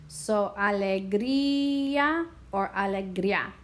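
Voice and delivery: alegría first with ía split, then with ia merged; comparing alegría. Shown as a demonstration. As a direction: contrast alegría split and alegría glided. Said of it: "decide if we hear two sounds or one".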